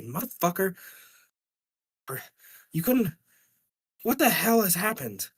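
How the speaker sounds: angry wife. Retold or imitated. Angry wife questions her husband.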